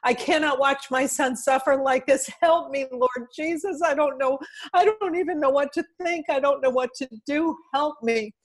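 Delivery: high-pitched, desperate plea to God, retold; anguished wail. Mother praying after her jailed son's call, anguished high wail. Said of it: "I uttered this deep guttural wail".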